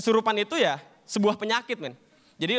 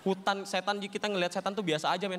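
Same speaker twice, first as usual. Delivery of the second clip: normal talking voice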